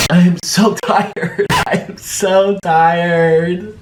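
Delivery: laughing. Pretend. Laughing complaint.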